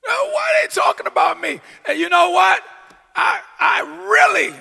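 high, loud preaching cry; preaching at full force. Real, not acted. Preacher firing up the congregation, high and loud. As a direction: lift the whole church with it.

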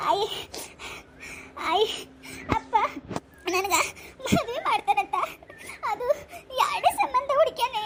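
put-on high, sobbing girl pleading through tears; crying niece. Weeping niece tells her aunt about the wedding, sobbing. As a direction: choke the words out between sobs.

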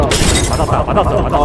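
sudden loud callout shout; grenade warning yell. Real, not acted. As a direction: yell it out mid-fight. Callout shout during a firefight. (다급한 외침)

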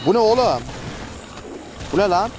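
surprised outburst. Real, not acted. Gamer startled by a crowd of enemies, surprised outburst. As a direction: blurt it out, caught off guard.

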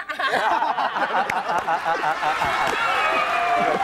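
full real laugh; big laugh. Real, not acted. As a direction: burst of laughter. Laugh after the joke.

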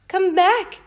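high, loud call after the scarf; shout. A narrator's reading. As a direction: yell it after him. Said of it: "shouted the man".

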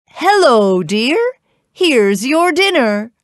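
high, loud, singsong giantess; wifely greeting. A narrator's reading. Giant woman greets her husband, cheerily. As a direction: call him cheerily to dinner.

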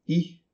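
"I" clipped short. Shown as a demonstration. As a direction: say "I" briefly. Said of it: "um I curto". ("I" curto)

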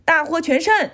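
high, triumphant call; acted jubilation; victory cheer. A narrator's reading. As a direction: cheer the win.

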